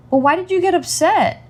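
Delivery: put-on high-pitched child voice; imitated questioning daughter. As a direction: ask as a puzzled little child. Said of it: "She's like".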